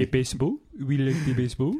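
mock French accent. Cohost playing a French baseball fan, French accent. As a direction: put on a comic French accent. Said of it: "you are the man of the accents".

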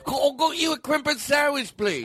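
high, shouty fake Liverpool accent; mock Liverpool accent. Host teasing Liverpool fans, high shouted mock Liverpool accent. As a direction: call out in a silly high Liverpool twang. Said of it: "affectionately make fun of our listeners from Liverpool".

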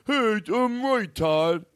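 high, slow mock smug voice; mock a smug know-it-all; mock smug Duncan. Todd mocking Duncan sitting with earphones in, smugly. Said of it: "and you're like".

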